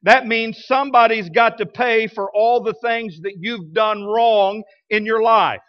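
ordinary talk

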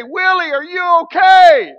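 high, loud, slow call of concern; alarmed shout. Retold or imitated. Pastor calling up to Willie through the ceiling, alarmed shout.

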